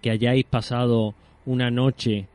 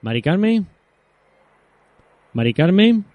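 louder, higher call down the line; 6 semitones higher, 5 dB louder than usual. Real, not acted. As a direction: call her name to get through. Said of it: "parece que no se escucha Mari Carmen". (llamando en voz alta)

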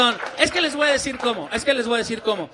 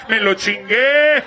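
slow, louder sinister "me lo chingué"; macabre gloat; 3 semitones lower, 7 dB louder, much slower than usual. Retold or imitated. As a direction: relish it like a villain, slowly. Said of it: "algo mucho más macabro". (regodeo macabro y lento)

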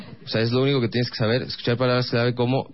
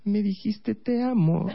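put-on much higher, shouted girlfriend retort; yelling girlfriend; 9 semitones higher, slower than usual. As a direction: snap it back high like an exasperated girlfriend.

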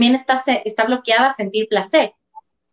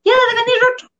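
mocking a scolding mother, rising ever higher and faster; dismissive mom; 8 semitones higher than usual. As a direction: brush the child off, climbing into a shrill mock-scold. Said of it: "no les digas". (imitando a una mamá que regaña, cada vez más aguda y rápida)

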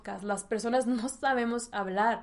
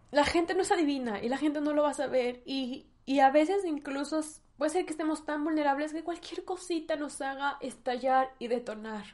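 usual speaking voice

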